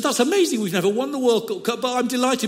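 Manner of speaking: high, bright hammed-up joy; mock delight. Old Dutchman delighted at God's answer, mock joy. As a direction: squeal it out in pretend glee. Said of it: "And he said".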